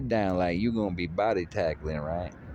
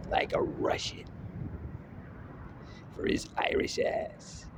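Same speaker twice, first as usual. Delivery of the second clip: whisper; 9 dB quieter than usual. Pretend. Hushed dramatic fight description.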